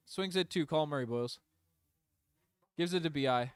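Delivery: ordinary talk, nothing marked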